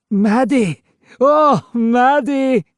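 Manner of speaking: high, loud, slow cry of joy; uncensored delight. A narrator's reading. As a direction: call her name, overwhelmed with joy. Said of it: "uncensored delight in the ghost's voice".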